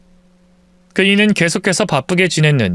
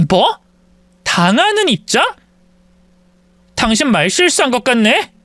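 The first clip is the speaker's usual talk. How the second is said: higher, sharp indignant retort; offended wife; 7 semitones higher than usual. Acted. Wife seizing on husband's words, indignant and higher. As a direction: catch his slip and throw it back.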